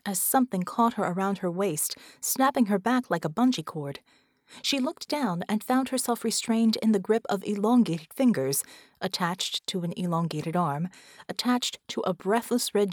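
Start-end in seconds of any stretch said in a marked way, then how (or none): none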